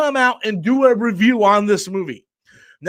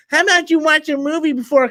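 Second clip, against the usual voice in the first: high-pitched mock troll voice; 6 semitones higher than usual. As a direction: read it as a mocking, put-on troll. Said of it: "let me talk in a troll voice".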